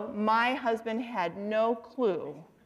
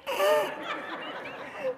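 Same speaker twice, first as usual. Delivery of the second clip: very high imitated snickering; dad's gleeful giggle; 5 dB quieter than usual. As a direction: giggle helplessly like a mischievous dad.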